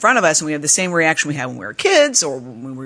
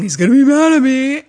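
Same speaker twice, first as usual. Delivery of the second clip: guilty fear; 9 semitones higher, 4 dB louder than usual. Retold or imitated. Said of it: "I felt I did something wrong".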